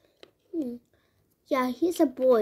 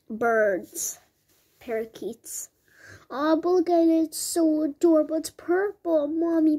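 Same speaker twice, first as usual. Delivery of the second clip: usual speaking voice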